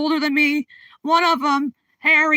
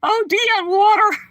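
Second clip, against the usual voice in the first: laughing; 6 semitones higher, 4 dB louder than usual. Real, not acted. Laughing fit on live stream.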